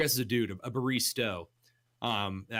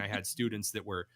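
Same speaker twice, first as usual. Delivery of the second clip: normal talking voice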